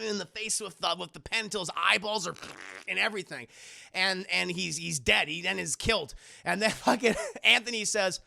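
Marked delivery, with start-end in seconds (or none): none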